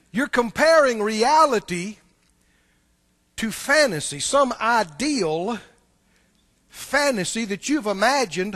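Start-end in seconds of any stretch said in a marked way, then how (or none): 0.1-4.2 slow, louder, each word weighed; deliberate slow emphatic repeat; said slowly. Shown as a demonstration. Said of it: "say that again real slow". 4.2-5.6 drawn out, slow. Shown as a demonstration. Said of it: "real slow".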